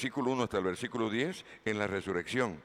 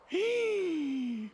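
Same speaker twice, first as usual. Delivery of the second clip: un sí muy agudo y sostenido; exclamación aguda. Real, not acted. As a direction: estalla con un sí triunfal. Predicador responde exaltado a su pregunta. (sí exaltado muy agudo)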